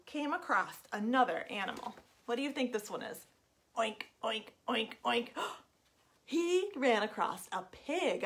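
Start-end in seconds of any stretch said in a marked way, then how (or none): 3.8-4.5 breathy whispered pig grunts; whispered oinks. Pretend. Storyteller whispering pig sounds for the kids to guess.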